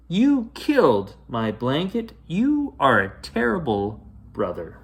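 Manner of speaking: put-on upset child shouting at her brother; angry yell. A narrator's reading. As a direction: blame him at full voice. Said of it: "the loudest yell possible".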